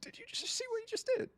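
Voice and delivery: high, quick, softened astonishment; real stunned amazement; hushed disbelief. Real, not acted. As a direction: ask it as if you cannot believe your eyes. Co-caster stunned by the play.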